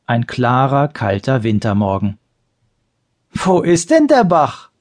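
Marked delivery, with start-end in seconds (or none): 3.3-4.7 asked through laughter; laughing. A narrator's reading. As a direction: laugh while asking it. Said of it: "lachte Thorolf".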